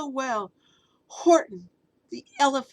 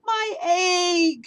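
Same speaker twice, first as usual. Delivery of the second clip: high, loud excited shout; 8 semitones higher, 7 dB louder than usual. Acted. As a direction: cry it out with joy. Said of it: "shouted Horton".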